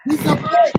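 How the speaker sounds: a high-pitched furious repeat of the insult; shrieked repeat. Real, not acted. Angry rant about wigs.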